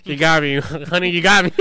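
a cop amused despite herself, laughing loudly. Retold or imitated. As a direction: caught out, laughing loud through the words. Cop laughs at his joke.

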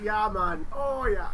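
put-on Jamaican accent. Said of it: "a Jamaican person".

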